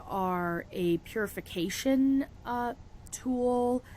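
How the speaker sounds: normal talking voice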